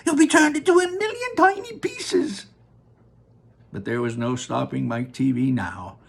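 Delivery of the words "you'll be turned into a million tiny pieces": high, panicked pleading; frantic mother. Acted. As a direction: beg him to come back. Mother screaming after son.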